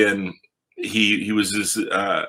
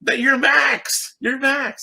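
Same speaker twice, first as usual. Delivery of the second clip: much higher, slow and delighted; excited recognition; slower than usual. Retold or imitated. Author meeting young fan Max, delighted and slow. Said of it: "we wrote in like".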